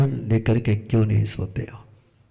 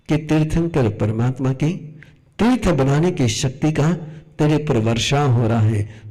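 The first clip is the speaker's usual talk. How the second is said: ordinary talk, nothing marked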